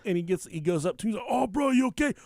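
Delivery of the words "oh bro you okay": loud, high panicked driver; imitated terror. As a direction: act out horrified alarm, voice shooting up. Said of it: "the sheer terror in this man's voice".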